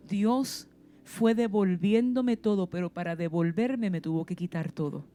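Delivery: ordinary talk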